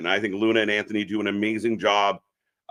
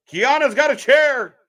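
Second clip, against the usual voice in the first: high, loud commentary call; excited call; 12 semitones higher, 6 dB louder than usual. Real, not acted. Telling chat what happens in the cage, excited high call. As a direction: call the chair spot like a fan.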